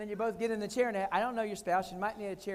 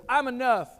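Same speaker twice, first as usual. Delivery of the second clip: emphatic declaration; 2 semitones higher, 8 dB louder than usual. Retold or imitated. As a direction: punch out I'm enough, loud and firm. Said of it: "I said the words".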